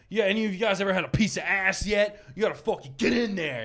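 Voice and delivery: a hint of a hyped-up coach; coach's pep talk. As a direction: imitate a coach egging on his players. Retells coach hyping up freshmen.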